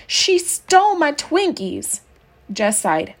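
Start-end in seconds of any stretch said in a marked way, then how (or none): 0.1-2.0 high, slow wail of a wronged first grader; mock kid wailing she's been robbed; tearful high child's cry; screaming child. A narrator's reading. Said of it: "come screaming towards them".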